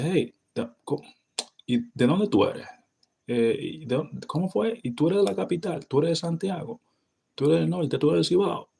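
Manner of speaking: very high, lively rapid questions; Dominican casual. Shown as a demonstration. Quizzing a new friend on their hometown, lively Dominican. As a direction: fire off the questions with excited curiosity. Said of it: "We say".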